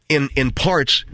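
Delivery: ordinary talk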